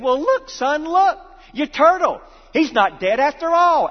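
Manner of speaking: dad announcing good news; cheerful dad. Retold or imitated. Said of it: "the dad said".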